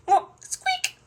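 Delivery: very high, loud squeal; piglet squeak. Acted. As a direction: squeak like a tiny animal. Storyteller voices little pig's squeak.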